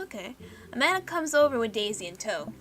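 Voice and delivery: ordinary delivery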